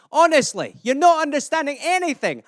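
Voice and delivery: exasperated Scot pressing the point; Scottish accent. Acted. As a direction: rattle off insults as an animated Scot. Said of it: "Are you not Scottish?".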